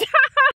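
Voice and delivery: short high laugh; quick high burst of laughter. Real, not acted. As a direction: break into a high-pitched giggle. Laughing after panicky plea.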